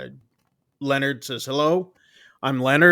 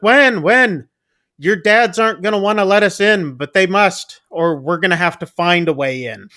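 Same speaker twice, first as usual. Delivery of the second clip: calling out; 5 semitones higher, 7 dB louder than usual. Retold or imitated. Leonard calls after fleeing girl.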